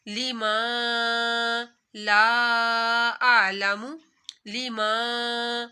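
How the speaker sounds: لِمَا لَا أَعْلَمُ with each long vowel drawn out slowly; slow tajweed reading of لِمَا لَا أَعْلَمُ, vowels held long; stretched Quran recitation. Shown as a demonstration. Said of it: "ایک الف کے برابر کھینچنا ہے".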